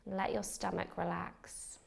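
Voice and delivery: calm, unhurried meditation guide with long pauses; measured meditation voice, leaving space for each breath; guided meditation. Acted. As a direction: lead a beginner gently into relaxation, phrase by phrase. Leads a beginner's meditation.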